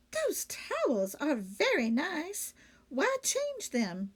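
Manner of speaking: wondering aloud why change them; puzzled maid. A narrator's reading. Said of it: "she thought".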